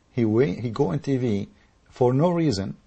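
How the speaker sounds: ordinary talk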